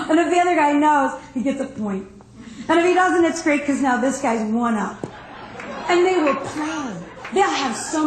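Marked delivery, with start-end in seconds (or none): none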